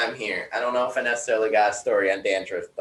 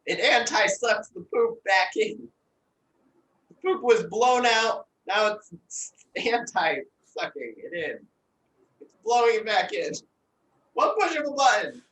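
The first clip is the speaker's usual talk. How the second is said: high menacing; 9 semitones higher than usual. Acted. Improv threat continues.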